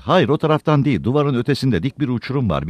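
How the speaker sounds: lightly acted cry of warning; urgent warning cry. A narrator's reading. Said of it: "diye haykırdı".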